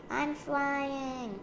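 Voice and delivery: put-on high, thrilled child's call; elated storybook exclamation; gleeful. A narrator's reading. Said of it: "laughed Lola".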